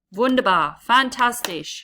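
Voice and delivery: loud, bright praise for the class; big teacher praise; excited praise. Real, not acted. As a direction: cheer them on.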